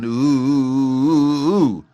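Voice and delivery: OOH held as one long, thinning sound; thin drawn-out OOH. A narrator's reading. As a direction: let OOH run on, rising in a long stream. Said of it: "runs out all thin".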